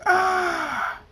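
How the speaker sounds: high, loud sustained "aah" scream; long high-pitched yell. Acted. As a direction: scream out in alarm.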